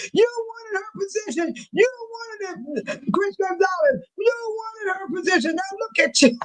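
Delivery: high, thin mock scream, held back in volume. Imitating people screaming at someone who wanted her position, mock scream. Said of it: "Y'all screaming".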